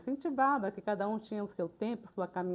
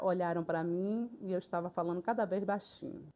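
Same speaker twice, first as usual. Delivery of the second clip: normal talking voice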